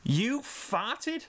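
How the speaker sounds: high, shocked question; disbelief. Acted. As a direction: cry out in disbelief. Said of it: "Sweetie cried, as in disbelief".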